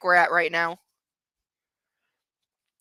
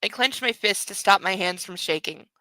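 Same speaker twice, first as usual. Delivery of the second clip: normal talking voice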